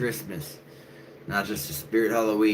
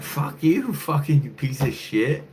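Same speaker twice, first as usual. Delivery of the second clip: high mock yell, slow; mock angry yell; retold angry yell; 8 semitones higher, slower than usual. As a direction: drag the insult out. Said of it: "I'm just like".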